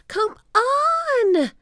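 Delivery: high, loud, drawn-out call; impatient shout. A narrator's reading. Annie shouting from the treehouse window. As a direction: shout to someone dawdling below. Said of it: "Annie was shouting from".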